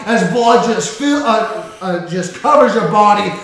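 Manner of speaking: loud, driving action narration; epic battle narration. A narrator's reading. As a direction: build the fight up big. Dramatic combat narration.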